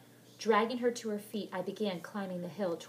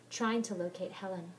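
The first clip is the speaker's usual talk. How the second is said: plain reading voice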